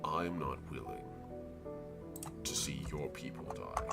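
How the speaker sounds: a patient ancient tree rumbling. Acted. Tree speaks at length.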